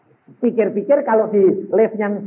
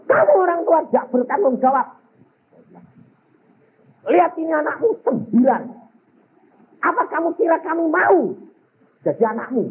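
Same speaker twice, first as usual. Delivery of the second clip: retold scolding; 12 semitones higher than usual. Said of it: "Saya bilang".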